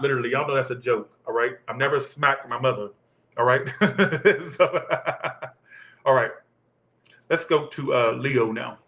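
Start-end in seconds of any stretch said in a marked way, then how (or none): 3.7-4.5 short burst of high, quick laughter; real chuckle. Real, not acted. Amused by own smack-my-mama joke, real chuckle. 4.7-5.5 short, falling run of laughter; real chuckle. Real, not acted. Still amused by the mama joke, tailing chuckle.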